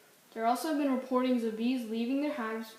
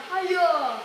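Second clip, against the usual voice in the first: loud, bright cheer up high; excited shout; 7 semitones higher, 8 dB louder than usual. Acted. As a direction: shout it out like an ad mascot. Peppy ad shout.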